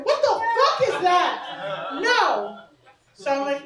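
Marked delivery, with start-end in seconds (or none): none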